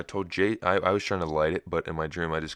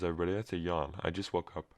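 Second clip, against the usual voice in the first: normal talking voice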